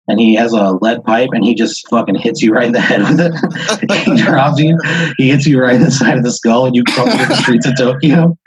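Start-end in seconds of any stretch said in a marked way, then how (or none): 3.4-4.0 high amused laugh; burst of laughter. Real, not acted. Game master laughing at his own twist, high laugh. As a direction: crack up at the twist.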